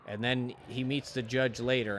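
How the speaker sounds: usual speaking voice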